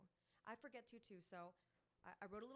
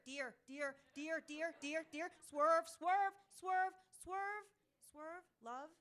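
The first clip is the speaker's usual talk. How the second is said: building shout; 7 semitones higher, 14 dB louder, slower than usual. Acted. Poem builds to a cry.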